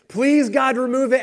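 acting out a desperate plea; mock pleading. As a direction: plead with God, hands clasped. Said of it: "Three times I pleaded, I begged God".

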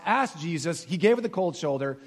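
ordinary talk, nothing marked

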